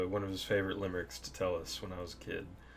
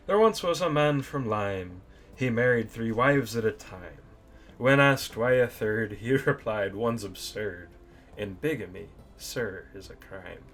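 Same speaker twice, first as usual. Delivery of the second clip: slow, louder sing-song limerick reading; limerick recitation; 2 semitones higher, 7 dB louder than usual. Acted. Reading his dad's favourite limerick to viewers, slow theatrical recital. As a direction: recite it with showman's relish, slowly.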